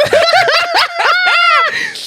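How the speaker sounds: high shrieking laugh. Real, not acted. Loud burst of laughter.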